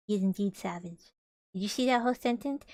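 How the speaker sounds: usual speaking voice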